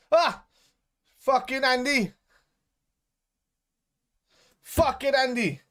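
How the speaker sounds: high, loud exasperated cursing; angry outburst. Real, not acted. Football fan cursing a player during the match, angry shouting. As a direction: curse the player in loud frustration.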